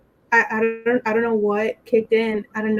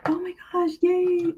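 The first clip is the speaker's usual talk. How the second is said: small, high-pitched cooing cheer; proud mom moment; 8 semitones higher than usual. Retold or imitated. Best friend having her proud mom moment, delighted. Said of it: "her little proud mom moment".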